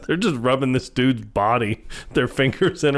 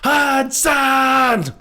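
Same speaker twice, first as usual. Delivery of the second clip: put-on high shouted Glasgow accent; broad Scottish accent; 12 semitones higher, slower than usual. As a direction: screech for help in a heavy Scottish accent. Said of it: "really heavy Scottish accent".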